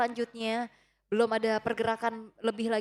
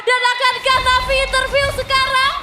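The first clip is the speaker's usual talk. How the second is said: screaming caster; 11 semitones higher, 10 dB louder than usual. Real, not acted. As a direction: scream it to the crowd. Caster screams over savage.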